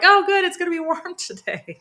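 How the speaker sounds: high-pitched imitation of excited children, breaking into a laugh; excited kids. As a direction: mimic kids' excitement, pitched well up. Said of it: "the kids were really excited".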